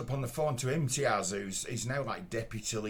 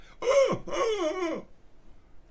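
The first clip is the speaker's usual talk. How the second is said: a sharp, high, offended gasp; mock gasp; 4 dB louder than usual. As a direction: gasp like a shocked prude. Ginger fellow reacts to swearing.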